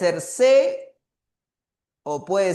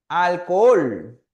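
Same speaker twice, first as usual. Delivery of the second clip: Alcohol said slowly, louder, with the weight on the final HOL; final stress shown; 5 dB louder, slower than usual. Shown as a demonstration. Teacher showing the class where alcohol is stressed, slow and emphatic. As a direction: draw out Alcohol and land hard on its last syllable. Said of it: "aguda, ¿no?".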